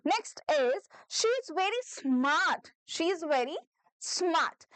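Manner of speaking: very slow, clear sentence for repeating; slow dictation. Shown as a demonstration. A teacher dictating slowly for students to repeat. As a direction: drag it out so the class can follow. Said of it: "उसको repeat करिए".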